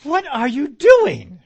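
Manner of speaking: high, loud, slow exasperated question; exasperated parent. Retold or imitated. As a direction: drag it out like a fed-up parent. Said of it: "they, they say".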